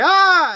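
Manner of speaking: loud, high imitated monster yell; sudden high-pitched scream, playing the monster. Acting out the monster's entrance.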